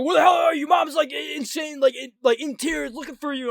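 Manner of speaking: retold high, loud frantic yell; brother's frantic shout. Brother to lost kid about worried mom, frantic shouting. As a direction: shout it like a panicked brother imitated.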